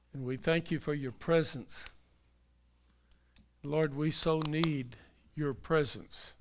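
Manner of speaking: closing prayer. Acted. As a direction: pray it aloud for the congregation. Pastor leads closing prayer.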